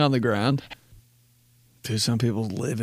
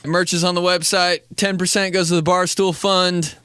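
podcast outro; 7 semitones higher than usual. Acted. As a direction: announce the outro with energy. Outro ad read.

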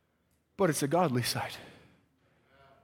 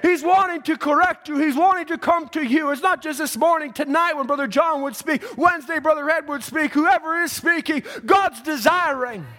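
high, loud, fervent sermon delivery; impassioned revival preacher; full-force preaching; 9 semitones higher, 6 dB louder than usual. Real, not acted.

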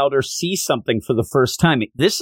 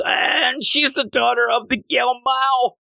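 panicked Yamcha; 10 semitones higher than usual. Retold or imitated.

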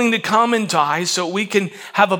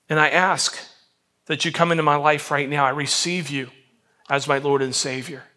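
lower, increasingly hushed prayer; sincere prayer; 3 semitones lower than usual. Shown as a demonstration. Models a prayer of salvation for listeners.

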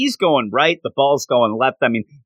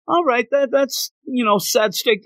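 mock coach; 10 semitones higher than usual. Coach brushing off player's excuse.

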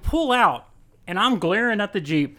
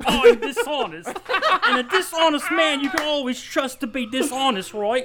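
higher, laughing pirate captain impression; Jack Sparrow voice; 7 semitones higher than usual. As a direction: ham up a pirate rogue, breaking into laughs. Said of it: "I'm Jack Sparrow".

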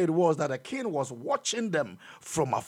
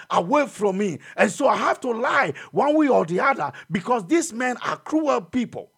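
high, raised voice speaking as Isaac; fiery preaching; 5 semitones higher than usual. Acted. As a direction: cry out Isaac's fear. Voicing Isaac's plight in sermon.

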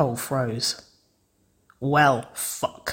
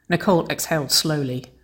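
ordinary delivery, nothing marked

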